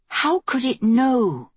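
high, drawn-out, incredulous fury held in; inward rage. A narrator's reading. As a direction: rage inwardly, pitch up and slow. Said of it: "she raged inwardly".

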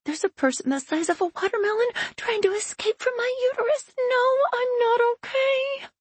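high, fast, strained complaint; strained pregnant woman. Acted. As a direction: push it out through gritted teeth, panting. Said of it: "Panting, she says between gritted teeth".

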